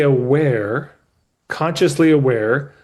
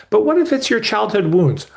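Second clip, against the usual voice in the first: lilting therapist question, pitched up high; mock therapist; 7 semitones higher than usual. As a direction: play the probing therapist with a raised voice. Voicing the gentle therapist.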